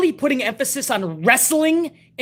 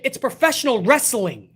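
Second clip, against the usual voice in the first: ordinary delivery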